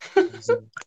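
short high burst of laughter. Real, not acted. Laughs at friend's joke.

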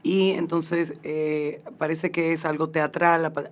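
normal talking voice

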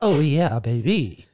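throaty voice. Acted. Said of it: "the throat hit is, it's so good".

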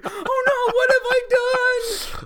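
imitating a horrified boy; squeaky mock dismay; panicked son. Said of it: "my son is freaking out".

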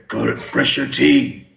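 lightly voiced Batman telling kids; a hint of Batman, giving orders. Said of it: "come out as Batman".

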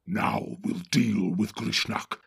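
gruff orc chief giving orders; Ugluk the orc captain. Acted. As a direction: bark orders at the cowardly Northerners. Ugluk speaks.

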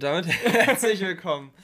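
loud, laughing welcome; forceful. Real, not acted. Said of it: "Dann musst du's kräftig machen". (kräftig und laut)

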